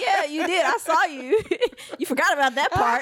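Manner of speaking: amused, laughing mock correction; laughing reply. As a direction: retell it through giggles. Amused retelling of her reply.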